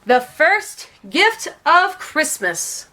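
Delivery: slow, high proclamation; Santa's grand slow call to the elves. A narrator's reading. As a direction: announce it to the whole crowd. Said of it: "and called out".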